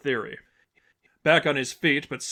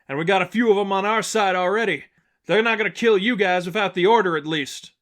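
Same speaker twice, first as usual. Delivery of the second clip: a raised, louder voice, higher, over the clash; speaking over a duel; 7 semitones higher, 6 dB louder than usual. Acted. Blackhawk arguing while dueling an ox, raised and loud. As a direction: push it out over the noise of a fight.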